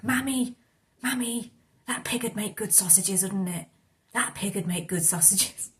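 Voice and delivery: put-on a little boy's Irish accent, lightly done. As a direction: imitate an Irish kid calling his mammy. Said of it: "this really strong Irish accent".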